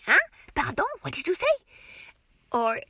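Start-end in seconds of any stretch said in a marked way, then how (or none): 0.0-1.5 playful teacher asking. Shown as a demonstration. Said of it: "I'd put my hand up and say".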